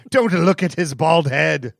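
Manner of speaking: slow, loud hammed-up caution; mock dramatic warning. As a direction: warn slowly with mock gravity.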